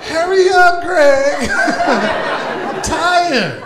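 high, loud nagging with a laugh; a hint of a nagging wife, high and loud; mock nagging spouse. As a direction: nag like an impatient wife. Voicing a tired spouse.

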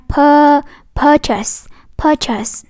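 "purchase" with "pur" held long; long first vowel. Shown as a demonstration. Teacher showing the long vowel, drawn-out "purchase". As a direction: stretch the first syllable of "purchase". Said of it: "cô đọc dài đó".

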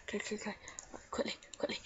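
quick urgent whisper; hurried whisper. Pretend. Gamer rushing for sticks, hurried whisper. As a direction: whisper it in a rush.

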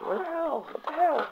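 frustrated reviewer, voice jumping high; squeaky exasperation. Real, not acted. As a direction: yelp it in disbelief. Missing gun, frustrated.

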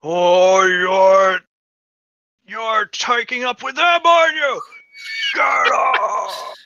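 high, full-force outraged shouting; booming voice. Acted. Voice from the trees confronts Hanji, booming shout. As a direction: bellow it, building to a roared order. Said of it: "a booming voice, that goes".

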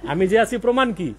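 ordinary delivery

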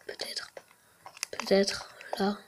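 hushed searching whisper; barely voiced, tense. Real, not acted. Searching scared in the dark.

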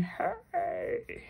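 high, soft little protest; old gentleman protesting. Acted. Old man at the crossing, high soft protest. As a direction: squeak it like an indignant old man. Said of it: "He's going".

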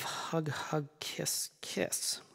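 soft unvoiced hug hug kiss kiss; whispered sign-off. Reading the letter's sign-off aloud, in a whisper. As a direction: breathe the kisses out.

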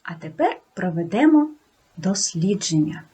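usual speaking voice

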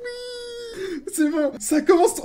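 very high, tearful laughing squeal; real hysterical joy. Real, not acted. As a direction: crack up in ecstatic disbelief. Overjoyed at game announcement. (fou rire de joie hystérique)

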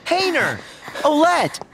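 high call across the square; calling names. Acted. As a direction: call each friend by name. Calling friends by name.

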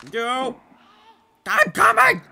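high, screamed urgency; panicked shout. Real, not acted. Player to companion while fleeing, panicked scream. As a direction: scream it to urge them on.

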